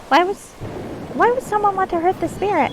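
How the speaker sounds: very high pixie questioning. Acted. Pixie asking the wisp about the spirit, very high voice. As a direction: ask it in a squeaky-high pixie voice. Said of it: "pixie voice when I'm not in character".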